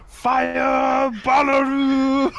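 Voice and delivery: high, drawn-out shout; loud, high-pitched playful war cry; hammed-up battle cry. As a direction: holler a mock spell cry, stretching it out. Player shouts out spell battle cry.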